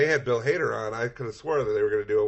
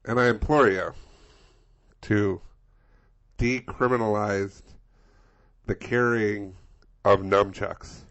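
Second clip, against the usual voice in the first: grand, slow, louder speechifying; mock governor speech; 9 dB louder, slower than usual. Playing the governor addressing the state, pompous oratory. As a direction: orate like a pompous politician at a podium. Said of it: "Governor Doug Ducey".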